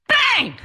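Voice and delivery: sharp high shouted bang; imitated bang. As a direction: burst out like an explosion. Mimicking explosion on plane.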